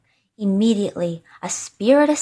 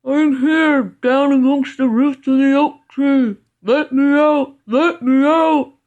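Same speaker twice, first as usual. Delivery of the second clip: high, raised, muffled pleading; smothered spirit voice; 5 semitones higher, 5 dB louder than usual. A narrator's reading. Trapped spirit calls from the oak roots, smothered cry. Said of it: "The voice answered".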